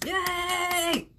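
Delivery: a delighted yay; excited cheer. Shown as a demonstration. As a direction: cheer like she did at home. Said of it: "I did it here at the house".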